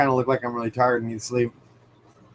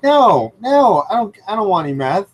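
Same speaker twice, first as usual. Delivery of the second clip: alarmed refusal; 7 semitones higher, 3 dB louder than usual. Retold or imitated. Refusing a dealer at store.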